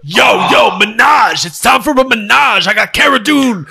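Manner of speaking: shouty rapper impression. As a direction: call it out like a hype rapper. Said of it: "[name] shows back up".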